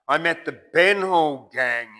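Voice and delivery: swaggy's tale. Acted. Swaggy tells his tale.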